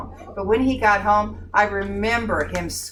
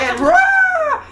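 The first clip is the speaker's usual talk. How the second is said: high, loud, playful mock lion roar; big cartoon roar, laughing through it; 8 dB louder than usual. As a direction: let it rip like a startled lion. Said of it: "the lion just went".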